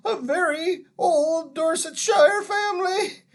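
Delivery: put-on high, loud, tearful old man's lament; wailing. A narrator's reading. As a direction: whine and wail in self-pity. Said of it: "it was wailing on".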